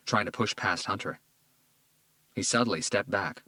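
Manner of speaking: ordinary delivery, nothing marked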